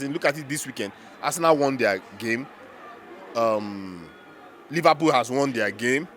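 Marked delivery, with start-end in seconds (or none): none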